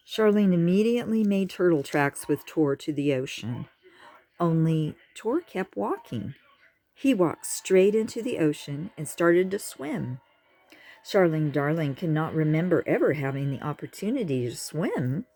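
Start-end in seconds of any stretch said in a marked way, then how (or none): none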